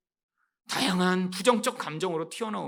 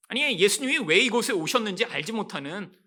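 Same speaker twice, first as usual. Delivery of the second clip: ordinary talk, nothing marked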